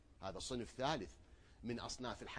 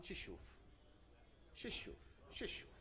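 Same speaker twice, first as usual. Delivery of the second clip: hushed question; 6 semitones higher, 11 dB quieter than usual. Acted. Preacher asking the crowd what they would see, hushed. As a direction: lean in and ask it quietly. Preacher asks repeatedly, fading.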